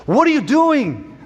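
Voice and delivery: high, stretched mock British policeman; hammed-up British officer's demand; botched British accent. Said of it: "I don't know what that accent was".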